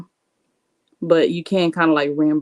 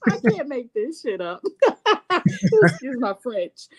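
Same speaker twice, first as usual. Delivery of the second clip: laughing; 9 semitones higher than usual. Real, not acted. Cracking up at own story.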